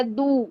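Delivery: دو with all the pressure at the front; wrong dal sound. Shown as a demonstration. Teacher describes the student's error, frontal dal. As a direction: say دو as a plain light dal. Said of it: "دال نکل رہا ہے".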